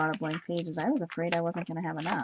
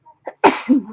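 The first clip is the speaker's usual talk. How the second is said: loud real cough; one sharp cough; 5 semitones higher, 15 dB louder than usual. Real, not acted. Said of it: "Excuse me".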